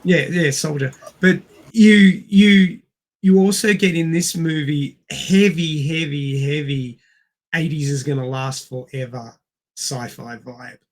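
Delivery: ordinary talk, nothing marked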